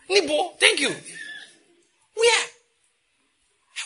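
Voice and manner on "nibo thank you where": pitched way up, squeaky and small; high squeaky boy voice. Retold or imitated. Preacher voicing the boy who wanted money, high and squeaky.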